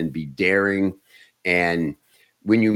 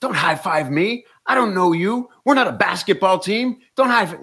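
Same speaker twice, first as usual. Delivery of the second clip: crazy screaming voice; 12 semitones higher than usual. Retold or imitated. Comic screaming at a high-fiving fan, manic yell. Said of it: "starts screaming at the guy in that crazy voice".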